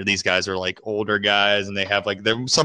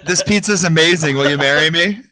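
put-on drunk gushing; 6 semitones higher than usual. Drunk proposal to waitress.

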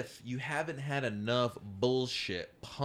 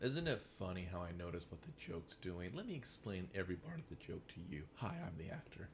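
low, quiet explainer aside; mock joke narrator; 5 semitones lower, 9 dB quieter than usual. Actor explaining the joke to everyone at home, mock narration. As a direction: drop low and soft, spelling it out. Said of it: "let me narrate the joke for everyone at home".

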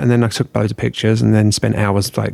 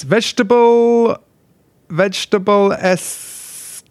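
poncy; 10 semitones higher than usual. Shown as a demonstration. Reading out own embarrassing blog name, poncy. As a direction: announce it pretentiously, way up in pitch. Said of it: "even that sounds poncy".

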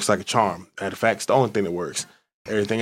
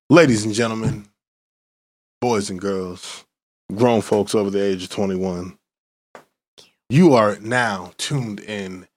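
ringmaster; 3 semitones lower, slower than usual. Acted. Hype intro of the podcast host.

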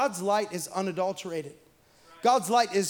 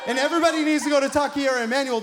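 excited preaching push; 5 semitones higher, 6 dB louder, much faster than usual. Acted. Urging church to visit restaurant.

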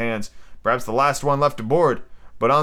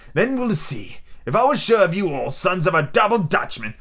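higher-pitched, animated buccaneer; pirate ringleader, impatient; 5 semitones higher than usual. Acted. Silver explains the plan to the coxswain, impatient. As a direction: spell out the scheme like an exasperated pirate.

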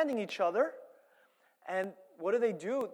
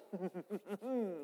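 scheming chuckle; 8 dB quieter than usual. Retold or imitated. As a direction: chuckle quietly like a plotting mobster. Imitates men hatching dark schemes.

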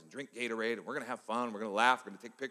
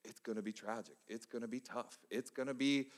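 stack each line higher toward a peak; building preaching; 6 semitones higher than usual. Acted. Pastor warns the congregation of hard seasons, rising preaching build.

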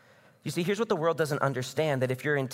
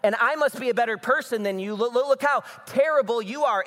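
higher, louder mock of a judging crowd; smug self-righteous mockery; 8 semitones higher, 4 dB louder than usual. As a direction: puff yourself up and sneer at the one who fell. Mocking self-righteous cancel culture.

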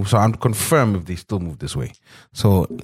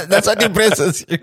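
teasing while laughing; 9 semitones higher than usual. Real, not acted. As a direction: laugh through the words. Host laughs at the guest.

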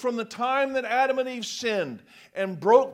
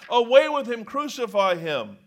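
louder crowd shout; yelled; 2 semitones higher, 7 dB louder than usual. Acted. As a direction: shout for his death. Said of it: "they yelled".

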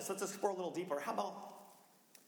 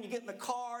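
ordinary talk

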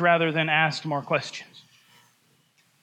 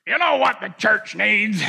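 higher, louder professorial drawl; professor's voice; 4 semitones higher, 4 dB louder, slower than usual. Retold or imitated. Professor holding forth to his class, in his odd drawl. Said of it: "and he talked like this".

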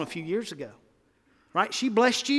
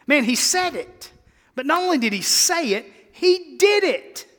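rising preacher shout; 9 semitones higher, 5 dB louder than usual. Real, not acted. Preacher building to a climax.

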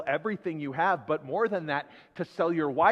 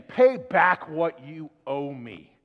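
slow, louder demand for the debt; stern demand; 8 dB louder, slower than usual. Acted. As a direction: press him slowly and hard to pay back. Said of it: "he demanded".

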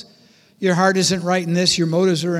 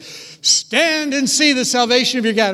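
loud, commanding proclamation; booming divine command; 4 semitones higher, 5 dB louder than usual. Retold or imitated. Moses relaying God's command to the people, loud and commanding. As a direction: declare it like God's own voice.

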